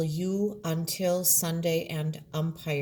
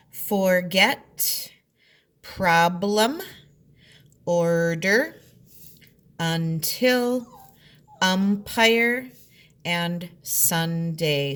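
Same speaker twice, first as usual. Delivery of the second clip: each word said louder and split into its syllables; 6 dB louder, much slower than usual. Shown as a demonstration. Teacher to third graders showing syllable division, loud and split. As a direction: sound out every syllable for the class. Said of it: "I want to show you the syllable division".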